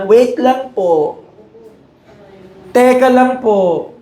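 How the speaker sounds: put-on child putting off mom to keep gaming; stalling child. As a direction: drag it out like a kid glued to games. Child stalling parent for games.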